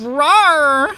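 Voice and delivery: high playful dinosaur rawr; a silly rawr at the table; playful monster growl. Acted. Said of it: "a deep voice to do good monster, uh, noises".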